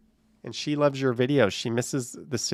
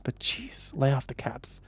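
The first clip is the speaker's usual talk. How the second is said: mild aside; 9 dB quieter, slower than usual. Real, not acted.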